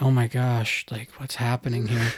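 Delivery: low, understated retold bewilderment; mutedly baffled, quoting your past self; bewildered. Kids watching Mike's breakdown, mildly bewildered. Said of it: "not sure what to do".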